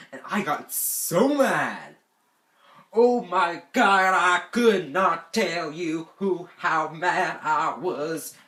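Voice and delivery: loud, slow, heavy emphasis; exasperated anger. Retold or imitated. Camper sprayed with bug spray while sleeping, loudly exasperated. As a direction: draw out each word, loud and heated.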